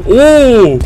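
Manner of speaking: a short loud startled cry; startled gasp. Real, not acted. Gamer startled by a creature, loud cry. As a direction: jump at the sight.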